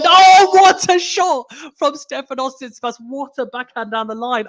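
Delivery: high, loud burst of amazement at the shot; excited shout. Real, not acted. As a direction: shout it out, thrilled. Commentator reacts to winning shot.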